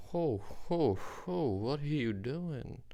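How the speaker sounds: jolly Santa greeting with a slow ho ho ho; Santa laugh. Retold or imitated. Storyteller voicing Santa finding him in a rut, hearty.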